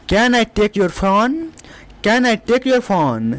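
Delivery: slow, careful English; slow teacher demo; slow model sentence. Shown as a demonstration. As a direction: dictate it slowly twice. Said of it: "इस type से बोलना है।".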